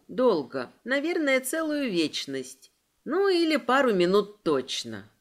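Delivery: plain reading voice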